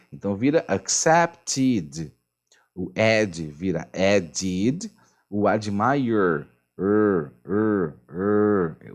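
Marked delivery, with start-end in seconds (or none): 0.1-4.9 "accepted" and "added" ending in a clear "-id"; teacher showing the "-id" ending; modelled "-ed" ending. Shown as a demonstration. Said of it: "tem que ter o som de I D". 7.5-8.9 "er, er" with a buzzing r; teacher showing how the "er" sound vibrates; vibrating er sound. Shown as a demonstration. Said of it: "o er vibra".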